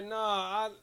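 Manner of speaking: usual speaking voice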